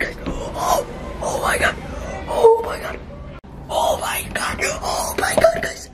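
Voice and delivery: breathy, urgent whisper; panicked whisper. Acted. Vlogger hiding in someone's house, panicked whisper. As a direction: whisper it in a panic so nobody hears.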